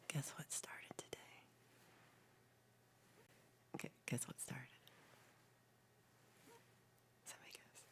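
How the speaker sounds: hushed teasing whisper. Real, not acted. Streamer whispering a teaser to her chat.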